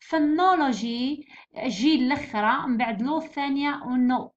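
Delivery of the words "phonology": Phonology slowly, with 'no' drawn out; stress on NO. Shown as a demonstration. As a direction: lean on the second syllable of Phonology. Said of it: "جي في النو".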